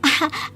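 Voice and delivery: a short bashful giggle before asking; shy giggle. Acted. Bride giggles shyly before asking her mother-in-law.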